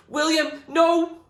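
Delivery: high, loud anguished cry; desperate scream. Acted. Zoe screams as William enters battle, anguished. Zoe watching him fall.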